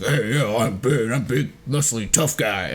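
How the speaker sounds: lightly voiced deep tough guy; a hint of a big muscly brute; deep Bane voice. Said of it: "like, a deep voice".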